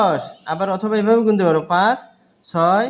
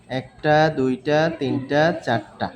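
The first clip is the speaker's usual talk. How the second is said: slow counting; 5 semitones lower, slower than usual. Shown as a demonstration. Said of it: "আমরা পঞ্চম কাঠিতে যদি যাই".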